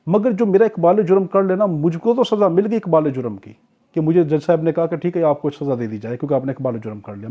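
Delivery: ordinary delivery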